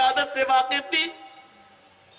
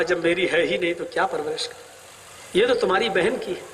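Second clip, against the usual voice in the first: lower, fast dismissive reply; father's refusal; 9 semitones lower, faster than usual. Acted. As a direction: brush it off as not his. Father refuses to raise girl. (باپ کا بے رخی سے انکار، تیز اور نیچی آواز)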